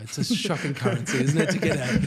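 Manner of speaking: laughing mock sympathy; amused, laughing. Real, not acted. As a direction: joke through real laughter. Joking about the huge payout, laughing.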